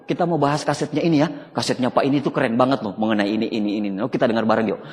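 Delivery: eager study buddy. Retold or imitated. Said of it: "Belajar gitu".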